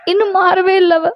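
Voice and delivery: mother sharing her distress; worried mother. Acted. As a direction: confide a daughter's worsening state as her troubled mother.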